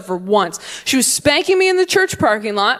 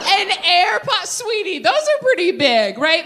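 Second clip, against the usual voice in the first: higher, incredulous condescending nurse; 8 semitones higher than usual. Retold or imitated. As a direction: patronize her gently, disbelieving. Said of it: "they were like".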